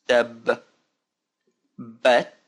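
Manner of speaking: TAB, long pause, then BAT; slow breakdown. Shown as a demonstration. Teacher pointing out the letters, slow split syllables. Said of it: "Let's do it slowly now".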